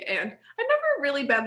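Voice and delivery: normal talking voice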